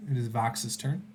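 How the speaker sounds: ordinary talk, nothing marked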